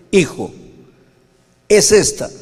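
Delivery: father cutting off the argument, firmly; stern father. Retold or imitated. Father tells his son no time to argue, stern and loud.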